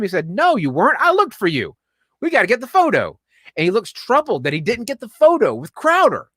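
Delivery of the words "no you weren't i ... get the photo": higher-pitched, insistent retort; mock angry. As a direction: act out mild exasperation. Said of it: "Eddie's mad at me".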